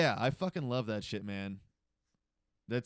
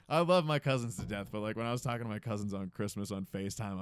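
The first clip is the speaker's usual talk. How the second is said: ordinary delivery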